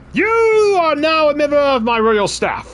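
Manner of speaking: king welcoming a servant to the royal staff, pompous; regal king voice. Acted. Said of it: "I could do the accent".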